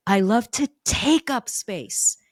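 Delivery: slow down and hit "take"; stress on TAKE. Shown as a demonstration. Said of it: "the emphasis on words".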